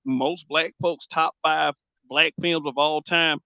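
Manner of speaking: ordinary talk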